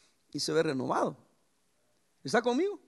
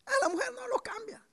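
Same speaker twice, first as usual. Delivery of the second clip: mock whine; 5 dB quieter than usual. Mock whining wife's excuse.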